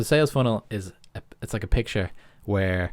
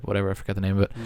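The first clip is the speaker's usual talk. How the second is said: usual speaking voice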